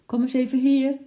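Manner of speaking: high, loud call through the glass. A narrator's reading. Mother calling Lotje through the window, loud and high. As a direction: call the girl in.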